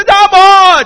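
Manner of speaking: "Jumbo" yelled high and loud, stretched; shouted Jumbo. Shown as a demonstration. Preacher showing the congregation how to shout the greeting, loud and high. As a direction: call "Jumbo" out full voice. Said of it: "你要叫Jumbo".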